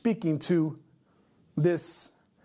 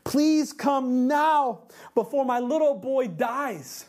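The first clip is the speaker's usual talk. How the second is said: higher, louder, drawn-out plea; urgent father's appeal; pleading; 4 semitones higher, 3 dB louder, slower than usual. Acted. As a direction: beg desperately, stretching the words. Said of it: "the official pleaded".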